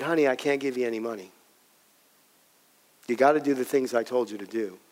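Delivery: low, soft and subdued; subdued refusal. Retold or imitated. Father refusing his tearful daughter money, subdued. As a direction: say it quietly, holding firm with a heavy heart.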